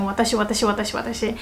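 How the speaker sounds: soft run of "watashi" with no pauses; repetitive droning. Shown as a demonstration. Showing learners how annoying constant "watashi" gets, repetitive droning. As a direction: reel off "watashi" over and over, quietly. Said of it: "if you just keep saying".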